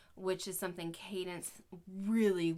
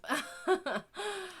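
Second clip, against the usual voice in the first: a high burst of relieved laughter; 13 semitones higher, 4 dB louder than usual. Real, not acted. Mom laughing that the roads opened.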